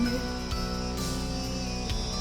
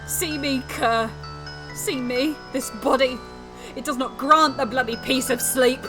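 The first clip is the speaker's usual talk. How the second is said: loud, raised-pitch tirade; angry cursing; 4 semitones higher, 7 dB louder than usual. Acted. Sleepless sufferer cursing the sleeping cur, furious. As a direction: rail at him in bitter fury.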